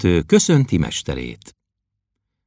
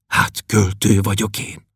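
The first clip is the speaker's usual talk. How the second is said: a heartbroken near-whisper; broken whisper. Acted. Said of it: "suttogta összetörten".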